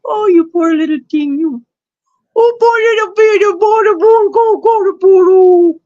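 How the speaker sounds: put-on high sing-song baby voice that trails off; mock baby talk. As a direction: coo over a crying baby, mockingly. Baby talk mocking the troll.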